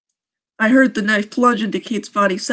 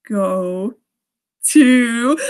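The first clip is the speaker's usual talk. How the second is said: menacing killer voice. Acted. Voicing killer again, one two three.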